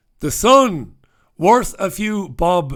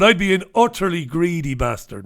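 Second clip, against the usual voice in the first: ordinary delivery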